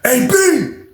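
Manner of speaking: high anxious call; worried call at the door. Retold or imitated. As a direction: call the brother's name. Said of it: "He was like".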